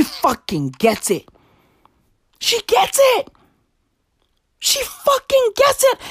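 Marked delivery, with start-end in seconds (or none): 2.4-6.0 squeaky high praise. Acted.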